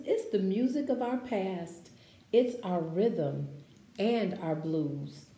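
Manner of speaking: slow, expressive picture-book read-aloud; reading to children. Acted. Teacher reading a picture book to her class, expressive read-aloud.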